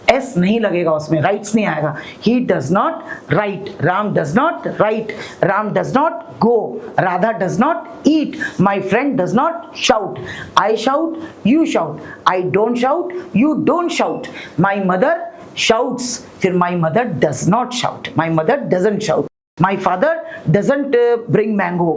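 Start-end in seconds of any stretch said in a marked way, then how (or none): 2.3-10.2 very slow, word by word; slow drill. Shown as a demonstration. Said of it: "teacher drills model sentences". 14.6-20.9 very slow, word by word; slow drill. Shown as a demonstration. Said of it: "teacher drills model sentences".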